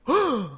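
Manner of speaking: high acted crowd gasp; sharp, high gasp of surprise; shocked gasp. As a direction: gasp in shock as all turn round. Crowd turns round, gasps.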